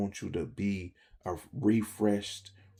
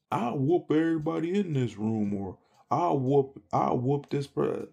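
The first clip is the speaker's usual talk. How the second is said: higher, louder mock tough-guy boast; tough-guy challenge; 5 semitones higher, 3 dB louder than usual. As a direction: play the loudmouth daring the room, hammed up. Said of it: "somebody to be like".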